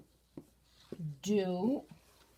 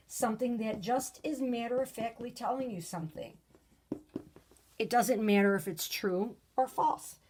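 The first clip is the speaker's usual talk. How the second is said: usual speaking voice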